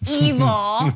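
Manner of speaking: slow, loud ominous "evil"; drawn out and menacing; dramatic evil. Retold or imitated. Zach turning evil, dramatic.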